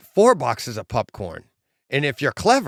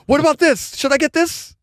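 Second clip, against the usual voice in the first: very high, loud giddy pleading; eager Carl; 13 semitones higher, 5 dB louder than usual. Retold or imitated. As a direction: beg like a kid in a candy store. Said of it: "he's like".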